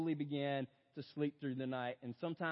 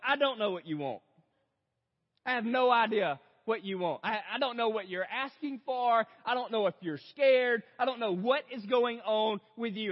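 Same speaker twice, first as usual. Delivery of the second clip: put-on raised, strained plea to a crying child; louder and higher, at wit's end; frustrated dad begging his toddler; exasperated pleading; 4 semitones higher, 6 dB louder than usual. Dad pleading over screaming toddler.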